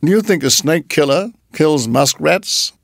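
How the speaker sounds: mild disdainful challenge; scornful. A narrator's reading. Mongoose snake killer answering a pleading muskrat, scornful. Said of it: "said Rikki Tikki scornfully".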